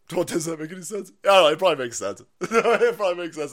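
ordinary delivery

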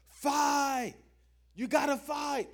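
high-pitched, loud rallying cry; team yell. Retold or imitated. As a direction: yell it like teammates urging on the batter. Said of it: "everybody on the team would yell".

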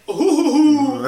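imitating Pianta cry. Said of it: "it's like".

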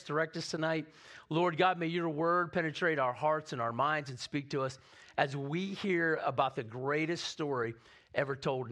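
pastor leading the congregation in prayer. Acted.

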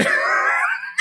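shrill airy cackle; high breathy shriek of laughter. Real, not acted. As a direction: wheeze out a laugh. Laughs at own remark.

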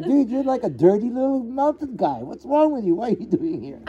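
put-on a touch of a scornful teen guard; rude boy guard. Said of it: "he's just like".

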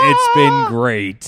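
slow drawn-out mock "It's been great"; mocking imitation. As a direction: imitate the ooh tone slowly. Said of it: "What was that first sound you said?".

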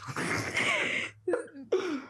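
high squealing laugh; breaking character giggling. Real, not acted. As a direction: burst out laughing. Laughing fit.

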